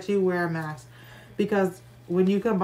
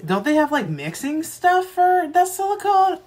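pitched up, curious question; high wondering voice; 9 semitones higher, 5 dB louder than usual. Retold or imitated. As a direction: lift the voice high, musing. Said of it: "So I was like".

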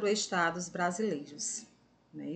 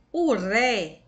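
"ré" said louder, set apart; stressed syllable; 8 dB louder than usual. Shown as a demonstration. Teacher isolates the tonic syllable for pupils, "ré" said strongly. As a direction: punch "ré" out on its own after a short "o". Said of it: "essa sílaba fica mais forte".

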